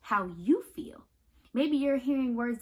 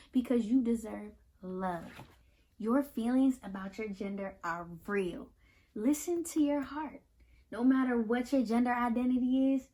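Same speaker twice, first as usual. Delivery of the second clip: ordinary delivery, nothing marked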